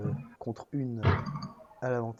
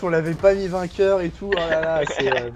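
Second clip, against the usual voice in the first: loud, higher, flustered caster apology; excited; 6 semitones higher, 10 dB louder than usual. Real, not acted. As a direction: gush it out after the big play. Excited apology after pentakill.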